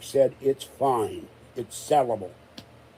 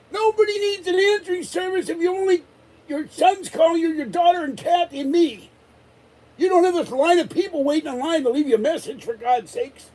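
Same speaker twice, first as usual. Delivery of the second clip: high-pitched indignant tirade, much higher; exasperated rant. Retold or imitated. Retold rant at Patty's answering service.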